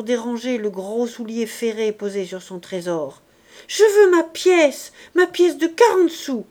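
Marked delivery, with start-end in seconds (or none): 3.7-6.4 loud, high tearful demand; a child shouting through tears; crying child. A narrator's reading. Child tugging at Jean Valjean's collar, loud tearful demand. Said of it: "L'enfant pleurait".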